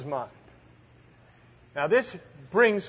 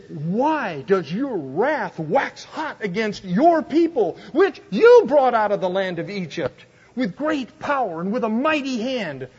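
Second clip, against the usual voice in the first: impassioned plea, high and loud; pleading with God; 6 semitones higher, 5 dB louder than usual. Retold or imitated. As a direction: beg him like your people's lives depend on it. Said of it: "He begged. He screamed. He shouted".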